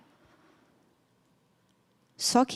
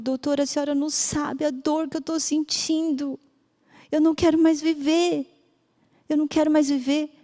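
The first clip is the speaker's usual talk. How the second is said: higher, voice breaking in despair; despairing mother; 5 semitones higher than usual. Retold or imitated. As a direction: plead like a grieving mother with nothing left. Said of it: "Ela falou". (mãe desesperada, voz embargada)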